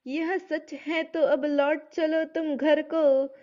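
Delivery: slow, measured verse recital; poem recitation. Acted. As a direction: chant each line slowly like a poem. Teacher recites Saket verse. (धीमा काव्य पाठ)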